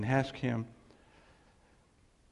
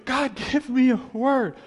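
mock pleading believer; 10 semitones higher, 8 dB louder, slower than usual. Mocking a believer's plea.

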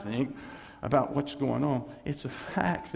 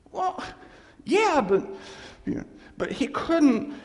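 much higher and louder, bursting out; excited; 11 semitones higher, 5 dB louder than usual. Real, not acted. Pastor bursting out about his friend, excited and loud. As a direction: let it burst out high.

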